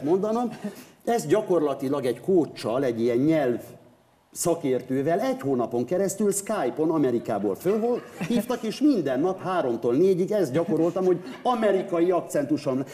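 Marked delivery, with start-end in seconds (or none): none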